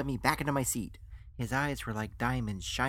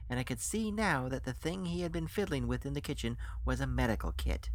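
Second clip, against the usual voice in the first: ordinary delivery, nothing marked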